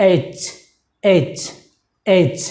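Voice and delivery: এইচ ending in a hard, pressed ch; correct pronunciation. Shown as a demonstration. Teacher modelling the letter after correcting it, firm ch ending. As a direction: put pressure on the final চ of এইচ. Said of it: "এটা আসলে".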